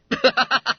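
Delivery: high, loud ha-ha-ha; group-style mocking laugh. Schoolboys laughing at the duped boy, hearty laugh. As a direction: laugh out loud at his expense. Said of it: "And they all laughed".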